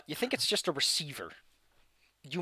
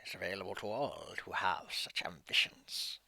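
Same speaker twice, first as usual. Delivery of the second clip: eerie whisper; 6 semitones lower, 8 dB quieter than usual. Acted. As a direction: tempt them with lichdom. DM voicing dark gift.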